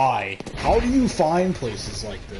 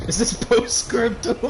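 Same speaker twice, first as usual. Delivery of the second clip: laughing question; 6 semitones higher, 3 dB louder than usual. Real, not acted. Laughing at corner spot.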